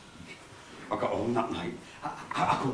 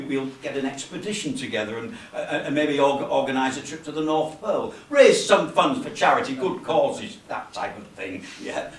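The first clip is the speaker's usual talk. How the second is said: ordinary talk, nothing marked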